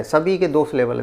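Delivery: ordinary talk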